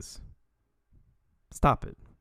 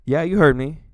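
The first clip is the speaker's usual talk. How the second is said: mock confrontation; 8 dB louder than usual. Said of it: "And I'd be like".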